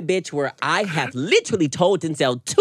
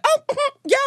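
much higher teasing mm-hmm, imitating him; mock teasing. Mimicking Denzel's joking tone about the boy, high mock tease. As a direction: tease it the way he did. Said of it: "you said it in, like, a joking way".

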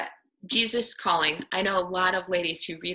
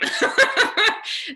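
high, loud breathy laugh; burst of laughter; 7 dB louder than usual. Real, not acted. Speaker to the women's group, struck by the Tozer quote, laughing.

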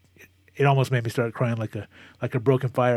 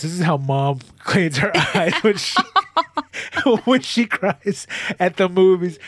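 high, giggling through the words; laughing realization; 5 semitones higher than usual. Real, not acted. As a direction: laugh at yourself mid-sentence.

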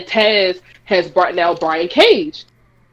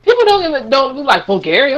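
ordinary delivery

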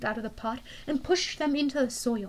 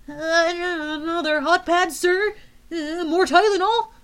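nervous guard; 6 semitones higher, 5 dB louder than usual. Acted.

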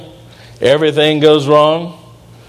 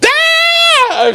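imitated baby scream, much higher; baby's scream. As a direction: shriek like a toddler joining the noise. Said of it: "and she starts going".

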